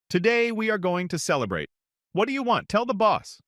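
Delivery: jovial commander. A narrator's reading. Commander celebrating his soldier.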